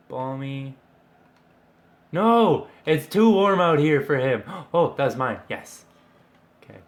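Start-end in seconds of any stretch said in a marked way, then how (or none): 2.1-4.4 loud dismay. Real, not acted. Reacting to missed chance.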